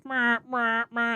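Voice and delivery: high imitated alarm beeps; alarm noise. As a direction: mimic a game alarm. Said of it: "just go".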